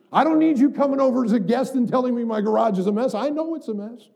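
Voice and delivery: rising from firm to loud and indignant; a believer bristling at being told to change, growing loud; defensive rant building. Pretend. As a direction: push back harder line by line until shouting.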